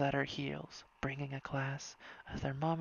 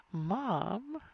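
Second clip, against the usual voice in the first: shouting; 5 semitones higher than usual. A narrator's reading. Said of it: "Marco shouted".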